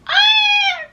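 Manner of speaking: loud, very high excited squeal; real overhyped shriek; hyped squeal. Real, not acted. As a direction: let out a piercing squeal of excitement. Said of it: "overly hyped right now".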